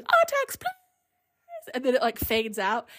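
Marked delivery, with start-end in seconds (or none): 0.0-0.7 Atreyu screaming. Retold or imitated. Boy's last plea to his horse, imitated high scream. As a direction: imitate a desperate scream in a squeaky falsetto. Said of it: "Atreyu screaming".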